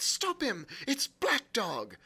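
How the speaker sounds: high-pitched urgent call; crying out. A narrator's reading. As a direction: raise the alarm, voice jumping up. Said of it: "I cried".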